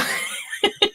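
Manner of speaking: real breathy giggle; breathless high giggle. Real, not acted. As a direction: let out a silent, high laugh. Laughing at the memory.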